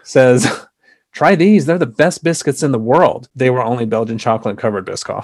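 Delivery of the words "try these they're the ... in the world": quoting mom. Retold or imitated. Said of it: "My mom, a civilian, says".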